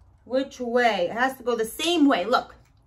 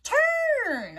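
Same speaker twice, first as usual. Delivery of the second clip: excited answer; 12 semitones higher than usual. Acted. Cheering the right answer.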